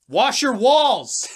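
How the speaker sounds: slow, high, very loud holler; big yell. Real, not acted. Fan ranting at team management about the arena, drawn-out yell. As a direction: bellow each word as an order. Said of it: "there's your big yell".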